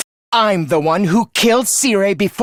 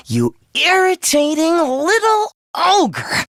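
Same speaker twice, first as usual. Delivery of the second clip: high, slow, spiteful jab; seething insult; 7 semitones higher, slower than usual. Acted. Villain insulting his nemesis Takumi, seething. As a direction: draw the insult out high and venomous.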